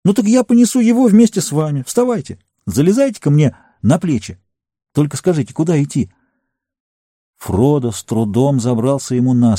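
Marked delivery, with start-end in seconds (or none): 0.1-6.1 high, urgent exclamation; eager, higher-pitched urging; exclaiming. A narrator's reading. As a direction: cry it out with rising resolve. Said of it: "воскликнул он".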